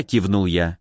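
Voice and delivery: ordinary delivery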